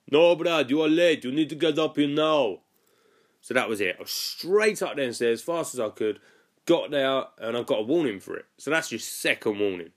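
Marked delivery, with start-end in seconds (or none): 0.1-3.8 mock Russian-accented manager, higher and louder. Manager phoning Brad, late for work, in a mock Russian accent. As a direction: ham up a Russian boss barking down the phone. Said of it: "that's just my accent that I think he does".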